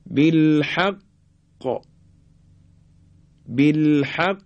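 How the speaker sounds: "بالحق" held on ق, then released hard; doubled-qaf qalqalah. Shown as a demonstration. Teacher showing doubled qaf at word end, "بالحق" held then bounced. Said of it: "مشدده و در آخر کلمه".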